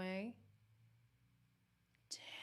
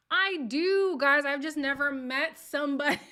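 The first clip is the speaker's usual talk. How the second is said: high, loud exclaimed protest; 6 semitones higher, 13 dB louder than usual. Real, not acted. Host defending themself to teasing viewers, loud protest. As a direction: cry out in your own defence.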